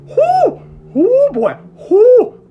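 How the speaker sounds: high, loud yelps of cold shock; cold shock whoops. Retold or imitated. Soaked in icy water, yelping whoo in shock.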